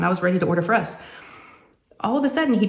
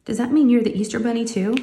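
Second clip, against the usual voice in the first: put-on higher, light little girl asking; curious daughter; 5 semitones higher than usual. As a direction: play the curious kid putting it together. Daughter asks about Easter bunny.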